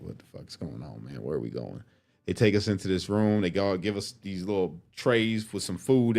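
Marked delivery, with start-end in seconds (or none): 0.0-1.8 low, quiet muttering; hushed confusion. Retold or imitated. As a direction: ask under your breath. Confused new inmates whispering.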